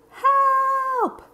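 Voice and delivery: very high, loud frightened yelp; falling child crying out; panicked cry for help. Acted. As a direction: scream as you drop. Carlos falling from nest.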